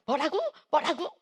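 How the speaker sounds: high, loud repeated asking; imitated hard-of-hearing query. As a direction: imitate a mother straining to hear. 못 알아들은 어머니 재연. (못 알아듣고 크게 되묻는 흉내)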